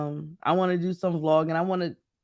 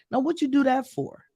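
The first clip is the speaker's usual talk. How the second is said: higher-pitched, incredulous telling-off; mock self-scolding; 8 semitones higher than usual. Traveler tells self off once on the plane, mock scolding. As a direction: scold yourself in playful retelling.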